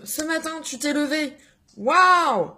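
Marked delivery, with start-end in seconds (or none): 1.8-2.5 loud, high mock-amazed wow; playful over-the-top amazement; excited wow. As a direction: cheer as if waking up were a miracle. Mock wonder at getting up.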